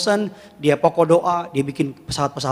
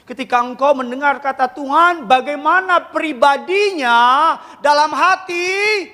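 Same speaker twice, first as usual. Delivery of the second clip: preaching at full force; 9 semitones higher, slower than usual. Acted. Preacher pressing the congregation, full-force preaching.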